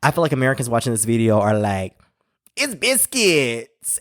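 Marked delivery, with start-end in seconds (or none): 2.6-4.0 high, slow mock American accent; imitating a Brit's put-on American accent; imitated American accent. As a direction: stretch it out in an exaggerated American accent. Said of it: "he just flipped into this American accent".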